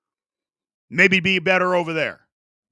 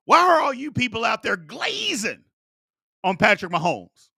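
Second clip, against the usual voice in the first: own spin on slang; 2 semitones higher than usual. Pretend. Host asking viewers in youth slang, playfully exaggerated. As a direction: ham it up, swooping up on "glazing". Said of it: "So my spin is".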